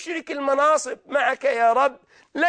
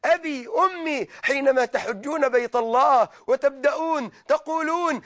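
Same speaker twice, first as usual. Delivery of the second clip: usual speaking voice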